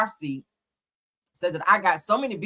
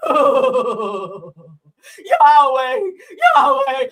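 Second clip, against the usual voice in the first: hammed-up sobbing cry of Yahweh, the second one much higher and louder; mock crying wail; 7 semitones higher, 9 dB louder, much slower than usual. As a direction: reenact her crying and wail up into a shout. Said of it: "see it and reenact it".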